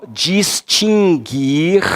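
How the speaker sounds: distinguir drawn out slowly for note-taking; slow dictation. Shown as a demonstration. Teacher dictating for students to write down, very slowly. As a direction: stretch distinguir syllable by syllable. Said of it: "se você quiser anotar aí".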